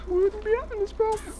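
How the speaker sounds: very high put-on falsetto; squeaky skit voice. Father-and-child skit about diapers, squeaky falsetto. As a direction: push it up into a squeak.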